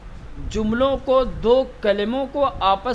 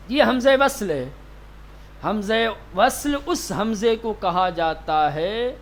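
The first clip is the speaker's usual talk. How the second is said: ordinary talk, nothing marked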